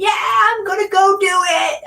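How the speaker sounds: high, loud gung-ho shout; excited shout. Retold or imitated. Psyching herself up to jump off the cliff, shouting. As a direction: fire yourself up before jumping. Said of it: "a video of me saying".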